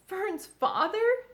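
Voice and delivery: high, slow tearful disbelief; mild sobbing. A narrator's reading. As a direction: let the words break a little. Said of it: "sobbed Wilbur".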